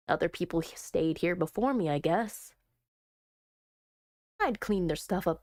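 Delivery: lightly voiced, low edgy heroine; a low, low-key loner; edgy girl, low voice. Reading Heather's dialogue.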